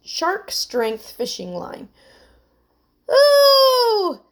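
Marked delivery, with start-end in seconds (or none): 3.1-4.2 high, loud "ooh" of frustration; exasperated groan. A narrator's reading. Emily fuming in the sinking boat, exasperated groan. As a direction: vent it through clenched teeth. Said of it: "said Emily through clenched teeth".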